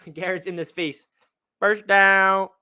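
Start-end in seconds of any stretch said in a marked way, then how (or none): none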